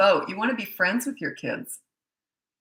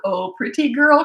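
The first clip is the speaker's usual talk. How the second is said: lightly imitated creepy admirer, slow and higher; playful mock coo, pitched up; 5 semitones higher, 6 dB louder than usual. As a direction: draw it out louder, as a teasing imitation. Son acted cooing over makeup victim.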